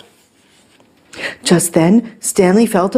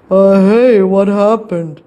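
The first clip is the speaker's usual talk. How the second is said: dazed, loud opening groan; a hint of a groggy character; lightly voiced burglar; 4 dB louder than usual. A narrator's reading. Head emerges from curtains.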